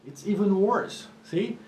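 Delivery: ordinary talk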